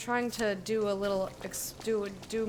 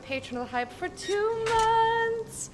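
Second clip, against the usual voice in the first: 1 hype announcer voice; 7 semitones higher, 4 dB louder than usual. Acted. Reading game's hype messages.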